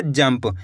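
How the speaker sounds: जंप with a short lip-closed m before प; half-m. Shown as a demonstration. Teacher answering how it is read, जंप with a half-m. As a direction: say जंप as jamp, one syllable. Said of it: "यहाँ पे आधा माँ का उच्चारण निकल गया".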